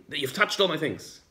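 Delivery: acted outrage, voice climbing high; angry Yaakov. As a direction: Yaakov fed up with Lavan's searching. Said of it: "Yaakov gets all angry".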